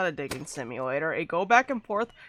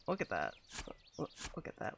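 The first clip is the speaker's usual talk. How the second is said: whispered dismay; 6 semitones lower, 13 dB quieter, faster than usual. Real, not acted. Dismay at the bad ramp.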